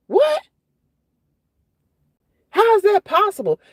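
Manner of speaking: high, incredulous questioning; baffled, pitch way up; stunned disbelief. Real, not acted. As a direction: blurt it out in real astonishment, voice jumping up. Stunned by what she reads.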